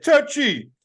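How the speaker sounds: high, loud cry; shouted alarm. A narrator's reading. Soldier shouting in the midst of the scuffle, alarmed yell. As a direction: shout it out sharply, pitched high. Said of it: "someone shouted".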